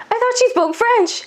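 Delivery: high, surprised mock remark; high puzzled nurse. A second nurse wonders about the patient's French, high and surprised. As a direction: pitch up a puzzled colleague.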